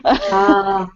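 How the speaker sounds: usual speaking voice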